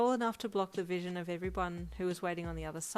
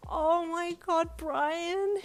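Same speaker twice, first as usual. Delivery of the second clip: much higher, louder, slow stunned screech; awestruck screech; 11 semitones higher, 7 dB louder, much slower than usual. Acted. Bride seeing her surprise transport, awestruck screech. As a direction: gasp it out in disbelief. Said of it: "you screeched with awestruck surprise".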